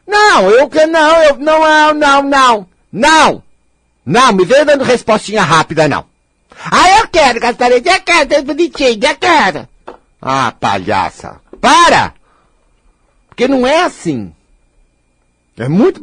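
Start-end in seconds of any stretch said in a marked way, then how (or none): 0.1-9.7 loud, high, huffy protest; hammed-up indignant whine; mock defensive retort. Mimics snappy defensive person. 10.2-14.3 scolding. Real, not acted. Scolds the imagined person.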